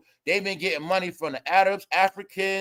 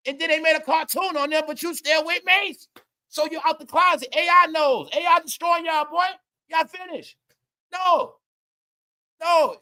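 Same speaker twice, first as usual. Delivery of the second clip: excited rant; 8 semitones higher than usual. Real, not acted. Vlogger laughing at the [name] clip, rising into an excited rant. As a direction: pitch it up as the outrage builds. Ranting about [name] and [name].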